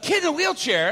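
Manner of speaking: high, loud shout; excited call. Retold or imitated. Older brothers to each other, excited shout. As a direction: call it out as you take off.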